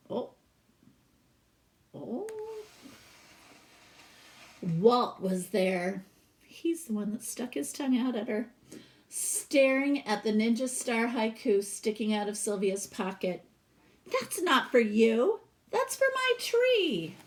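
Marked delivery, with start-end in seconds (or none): 4.6-15.4 lively read-aloud storytelling voice; storybook reading. Acted. Teacher reading a picture book to her class, expressive read-aloud. 15.7-17.2 put-on higher, possessive child voice; protective little girl. Sylvia telling Walt the poem is for her tree, higher protective child voice.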